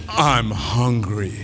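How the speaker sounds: plain reading voice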